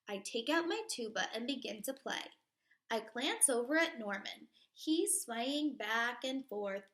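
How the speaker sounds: put-on lively read-aloud for kids; storybook reading. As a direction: read it to a class like a picture book. Reading picture book aloud.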